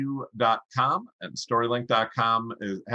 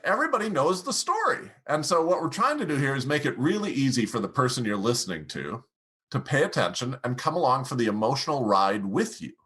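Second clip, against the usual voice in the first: ordinary talk, nothing marked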